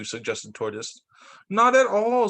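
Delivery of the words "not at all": high and louder, brushing off worry; cheerful insistence, pitched up and pushed out; eager Rabbit. A narrator's reading. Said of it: "said Rabbit".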